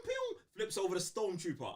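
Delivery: ordinary talk, nothing marked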